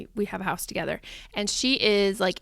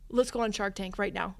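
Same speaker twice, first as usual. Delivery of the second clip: ordinary talk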